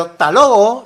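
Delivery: emphatic "hasta luego", shrunk to "talogo"; reduced form. Shown as a demonstration. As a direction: call out "hasta luego" as "ta logo". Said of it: "es un habla espontánea".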